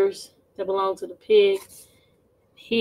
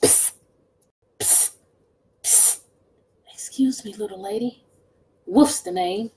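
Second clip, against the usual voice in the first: sly wolf whisper; 3 semitones lower than usual. Acted.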